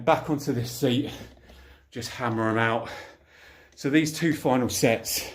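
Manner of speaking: normal talking voice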